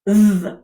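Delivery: "th" voiced as in "this", tongue between teeth; voiced dd like English this; Welsh dd sound. Shown as a demonstration. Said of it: "Two fingers and say th".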